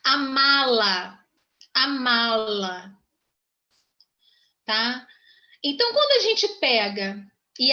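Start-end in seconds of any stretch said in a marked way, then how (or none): 0.0-3.0 "Amá-la" very slow, each vowel stretched; drawn-out demonstration. Shown as a demonstration. Teacher showing the class the verb amar with pronoun, drawn out. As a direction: stretch out "Amá-la" as a model pronunciation. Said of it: "então a gente fala assim".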